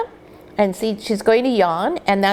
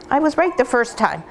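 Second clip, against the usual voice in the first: usual speaking voice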